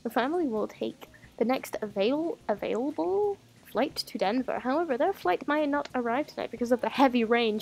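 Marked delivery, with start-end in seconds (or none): none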